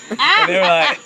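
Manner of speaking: can't hold the laugh in. Real, not acted.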